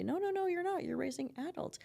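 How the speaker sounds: mock-insistent contradiction; playful correction. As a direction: cut in to set a friend straight. Acting out correcting a friend.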